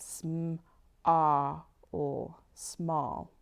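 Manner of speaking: "sm-, ah" split out, then "small"; sounded out small. Shown as a demonstration. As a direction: segment "small", punch the ah. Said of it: "I can hear it as I say it".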